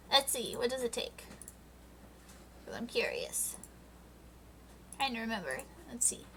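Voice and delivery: soft unvoiced whisper to herself; quiet muttered-to-self whisper; whispered aside. Real, not acted. Aside while looking it up.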